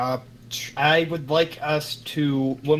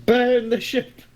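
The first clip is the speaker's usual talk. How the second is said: mob shout; 9 semitones higher, 5 dB louder, slower than usual. Acted. Callback to mob chant.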